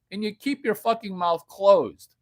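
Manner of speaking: usual speaking voice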